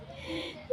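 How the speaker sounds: a short, soundless, breathy laugh. Real, not acted. Laughing after telling the suitor she is not kind, breathy giggle. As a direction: giggle at her own joke. Laughing at her own retort.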